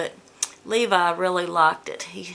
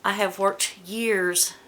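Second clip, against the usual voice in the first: usual speaking voice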